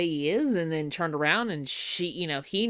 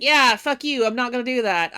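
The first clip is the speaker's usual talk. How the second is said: loud, higher defiant retort; mock defiance; 5 semitones higher, 8 dB louder than usual. Girl told to avoid the werewolf, defiant. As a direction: flatly refuse the advice. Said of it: "then she's like".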